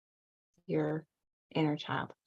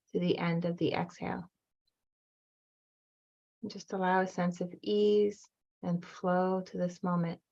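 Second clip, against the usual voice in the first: calm, even meditation voice with long pauses; guided meditation. Acted. Guide leading listeners back to their inner child, calm meditation voice. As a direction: guide them gently, leaving room to breathe between phrases.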